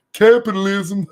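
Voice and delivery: high mocking voice; exaggerated mock voice; mocking quote. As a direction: sneer it like a know-it-all. Mocking commenter's reply.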